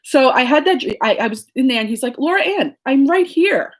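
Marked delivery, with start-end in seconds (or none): 2.2-3.7 lightly voiced grandfather, pitched higher; a hint of a gentle old grandpa calling out; grandfather's voice. Grandfather voiced in dream story.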